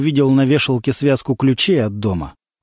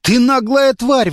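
higher, slow indignant outburst; outraged; 10 semitones higher, slower than usual. A narrator's reading. Lyonya turns on the girl, outraged. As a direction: spit each word in outrage. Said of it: "возмутился Лёня".

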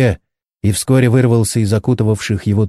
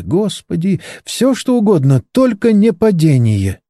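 frightened plea; 8 semitones higher than usual. A narrator's reading. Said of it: "лепетала Моника".

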